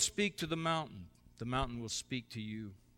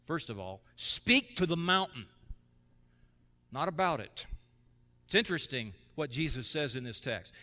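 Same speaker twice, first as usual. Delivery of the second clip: ordinary talk, nothing marked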